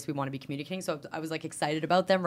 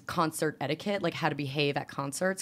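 ordinary talk

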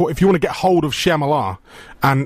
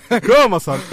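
high, louder terrace cheer; cheering call; 7 semitones higher, 3 dB louder than usual. Pretend. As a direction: egg him on like a fan. Cheering friend on.

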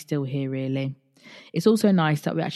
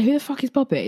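baffled outburst; 10 semitones higher, 3 dB louder, faster than usual. Retold or imitated. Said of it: "And I say".

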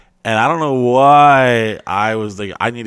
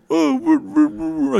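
high whooping; mock celebratory whoops. Dave cheering down the hallway, high whoops. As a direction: whoop like a winner.

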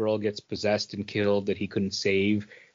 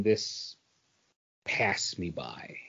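slow breathy whisper, letting it fade; hushed, unhurried, barely voiced; 4 semitones lower, 9 dB quieter, slower than usual. Real, not acted. Trailing dramatic understatement.

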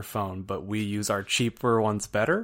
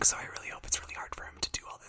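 ASMR whisper; 9 dB quieter, much faster than usual. Pretend. Continues the whisper bit.

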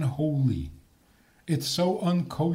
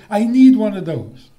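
louder, higher mock child's demand; 5 semitones higher, 10 dB louder than usual. Teacher acting out a child who wants something, mock demanding. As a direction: act out a child insisting, loudly. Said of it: "So a child says".

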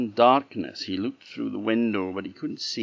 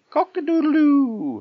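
cockerel crow; 9 dB louder than usual. A narrator's reading. Cockerel joins the noise to scare robbers, crowing loudly. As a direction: crow it out long and loud like a cockerel. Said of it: "the cockerel went".

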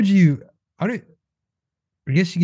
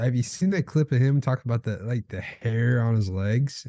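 usual speaking voice

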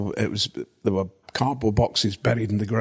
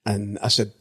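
ordinary talk

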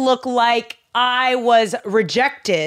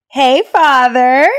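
much louder, drawn-out hey Father; loud slow greeting; 9 dB louder, slower than usual. Pretend. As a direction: call out slowly.

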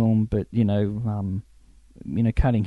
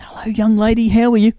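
high, louder gallant hello; charming greeting; 10 semitones higher, 6 dB louder than usual. Retold or imitated. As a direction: greet a young fan with charm. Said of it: "And he says".

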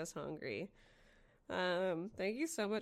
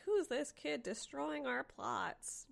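mock annoyed fans; 7 semitones higher, slower than usual. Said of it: "we're all just like".

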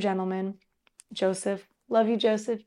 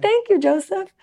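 sweet thanks; 9 semitones higher, 5 dB louder than usual. Retold or imitated. Thanking Joseph in retelling.

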